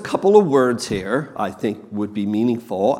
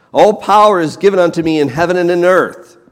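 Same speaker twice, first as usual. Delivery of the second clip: proclaimed quote; 4 semitones higher, 6 dB louder, faster than usual. Acted. Preacher proclaims the first statement.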